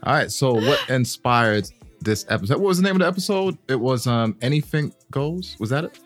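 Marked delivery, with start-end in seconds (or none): none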